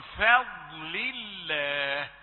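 فضل الله very slow with heavy dad; Quranic recitation. Shown as a demonstration. Teacher recites the verse, drawn out. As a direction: chant فضل الله slowly. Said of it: "في كلمة فضل دي".

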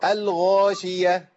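الغاشية with a clear غ, slow; correct ghain. Shown as a demonstration. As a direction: stress the غ in الغاشية. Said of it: "الخاشية ليه". (نطق الغين واضحة)

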